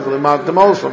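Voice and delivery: "V'limad tem osam" slurred into one word; words blurred together; run together. Shown as a demonstration. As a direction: glide "tem osam" with no pause before the aleph. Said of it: "it sounds like one word".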